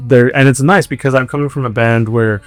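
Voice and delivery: ordinary talk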